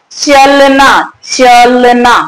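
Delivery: "चलना" stretched slowly with the force on opening च; stress on च. Shown as a demonstration. Teacher showing where the force went, drawn-out stressed "चलना". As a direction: lean long and hard on च in "चलना". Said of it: "शक्ति कहां चली गई? च पर".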